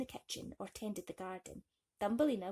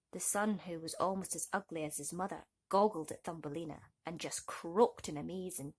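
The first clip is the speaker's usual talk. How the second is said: ordinary delivery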